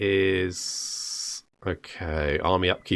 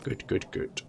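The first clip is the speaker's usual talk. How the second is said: breathy whispered good good good; 4 semitones lower, 13 dB quieter, slower than usual. Real, not acted. Ruler whispers over rising income, gleefully whispering. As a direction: whisper it greedily to yourself.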